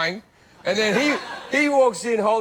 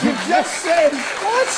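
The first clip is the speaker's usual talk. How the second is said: much higher, louder disbelief; can't believe what he just heard; incredulous outburst; 10 semitones higher, 3 dB louder, much slower than usual. Retold or imitated. Comedian rants at a guest on a talk show, incredulous and loud. Mock outrage at stuffing story.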